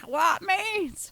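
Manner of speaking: high-pitched teenage challenge, acted out; scrappy fourteen-year-old. Scouser acting out squaring up to someone in their face, scrappy fourteen-year-old. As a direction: snap back like a cocky Scouse teen. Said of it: "go back to being fourteen".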